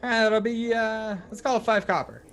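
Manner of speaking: high-pitched gnome woman voice; gnomish barmaid. Acted. Gnome barmaid naming the price to a customer, high gnome voice. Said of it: "looks at you and goes".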